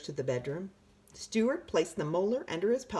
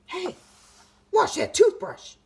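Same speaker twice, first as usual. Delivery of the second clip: high, loud shout; 14 semitones higher, 9 dB louder than usual. A narrator's reading. Tooth yelling at the boy brushing, high shout. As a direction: yell it up high like a tiny tooth. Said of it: "shouted the tooth".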